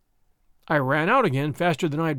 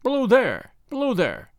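raised, higher-pitched hailing call; crying out; 5 semitones higher, 4 dB louder than usual. A narrator's reading. Signalman recounting a voice heard by moonlight, crying out. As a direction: hail someone below, pitched up. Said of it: "when I heard a voice cry".

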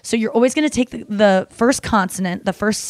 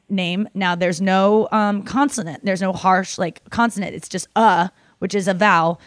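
usual speaking voice

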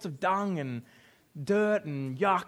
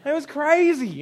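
ordinary talk

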